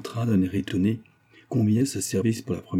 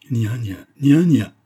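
higher, louder cry of the name; outraged exclamation; 3 semitones higher, 8 dB louder, slower than usual. A narrator's reading. Mistress rising from her chair at the nanny, crying out. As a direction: cry out to silence the servant. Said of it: "s'écria madame Karsdorff".

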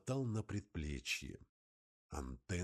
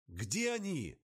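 urgent radio call; 7 semitones higher than usual. Acted.

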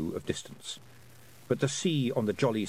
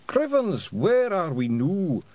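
high, loud, drawn-out Scottish bewilderment; Daft Woolly; 7 semitones higher, 5 dB louder, slower than usual. Acted. Pixie lost in a dream, loudly baffled. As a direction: wail the question in baffled Scots.

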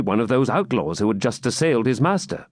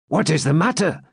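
an eager, higher swineherd's question; 7 semitones higher than usual. A narrator's reading. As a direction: ask it eagerly, keen to know. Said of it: "said he eagerly".

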